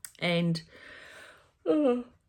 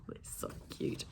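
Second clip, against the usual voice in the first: hushed, breathless whisper of delight; 14 dB quieter than usual. Real, not acted. Crafter gushing over the guinea pig picture, whispering. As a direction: whisper it like the cuteness is too much.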